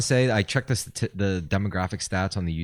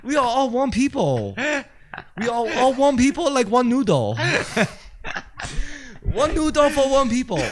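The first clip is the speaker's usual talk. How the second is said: high-pitched mock Chinese accent; hammed-up Chinese accent, voice way up; 13 semitones higher than usual. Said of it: "Chinese and Japanese accents there".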